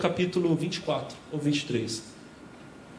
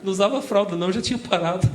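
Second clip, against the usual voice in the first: laughing reply; 2 semitones higher, 4 dB louder, faster than usual. Retold or imitated. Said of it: "Eu falei assim".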